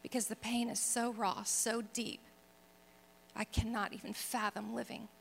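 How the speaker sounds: soft, subdued plea, retold; understated crying out. Woman alone in her apartment begging God, in a hushed plea. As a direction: plead quietly, with pauses between the phrases. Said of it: "crying out to this God".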